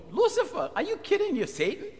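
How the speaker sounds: high, incredulous outcry; can't believe the insult; shocked disbelief. Acted. Peter reacts in shock at being called Satan, high and incredulous.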